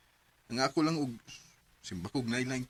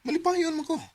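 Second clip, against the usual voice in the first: much higher, louder protest; angry outburst; 14 semitones higher, 7 dB louder than usual. Acted. Dodoy snapping back at Chris, much higher. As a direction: defend yourself hotly. Couple quarrel about drinking.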